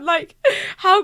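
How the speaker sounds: normal talking voice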